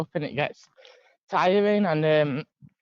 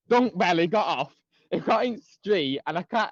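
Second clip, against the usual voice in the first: usual speaking voice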